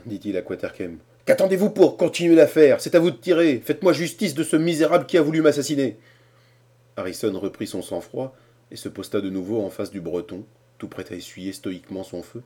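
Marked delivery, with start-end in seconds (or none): 1.3-6.0 loud, high demanding voice; indignant demand. A narrator's reading. Cornelius urges Quaterkem to shoot Harrison. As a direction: insist furiously on revenge. Old man demands the duel continue.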